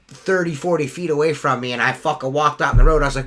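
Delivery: normal talking voice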